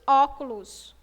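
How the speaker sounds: Óculos loud, open ó. Shown as a demonstration. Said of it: "Falou a pronúncia aberta". (Óculos com ó aberto, repetido)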